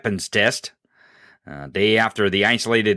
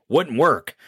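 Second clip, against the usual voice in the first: ordinary delivery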